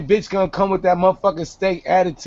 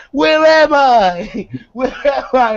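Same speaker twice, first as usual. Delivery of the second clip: mock lost girl; 7 semitones higher, 4 dB louder, slower than usual. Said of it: "bitch, you standing in the middle of Midtown".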